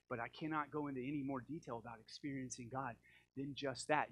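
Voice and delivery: normal talking voice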